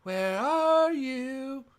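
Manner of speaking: high, slow sing-song call; drawn-out playful call into the void. Acted. Kid searching aloud for the Jedi, slow sing-song call. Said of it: "kid calls out for Yoda".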